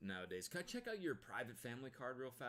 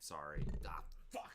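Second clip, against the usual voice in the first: ordinary talk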